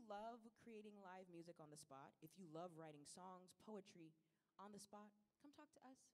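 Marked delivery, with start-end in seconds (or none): none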